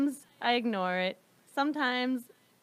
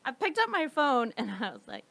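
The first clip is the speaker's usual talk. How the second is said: usual speaking voice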